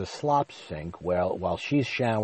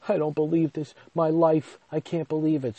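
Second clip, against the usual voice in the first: pitched up, pleading and tearful; despairing disbelief; 8 semitones higher than usual. Retold or imitated. Crying over the tub, he pleads in tearful disbelief. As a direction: wail it like you're close to tears. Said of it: "literally, like, crying".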